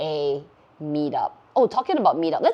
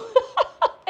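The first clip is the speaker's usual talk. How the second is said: high-pitched burst of giggling; squeaky laugh; high giggle; 4 dB louder than usual. Real, not acted. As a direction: giggle at the rude pun. Laughing at the funny name.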